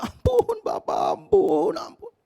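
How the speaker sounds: very high, slow wailing plea; bleeding son wailing to his father; desperate begging. Retold or imitated. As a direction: wail for mercy, fading away.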